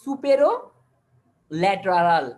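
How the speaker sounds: two-part emphatic model of "supero lateral"; combined term stressed. Shown as a demonstration. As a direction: say "supero" with a rise, then drop hard on "lateral". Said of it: "কি বলবো তাহলে এটাকে".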